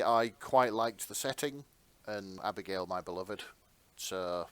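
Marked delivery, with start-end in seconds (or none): none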